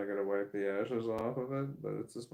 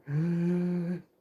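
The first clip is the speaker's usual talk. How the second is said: panicked gasp; 6 semitones higher, 4 dB louder than usual. Acted. Acted friend freaking out on drugs.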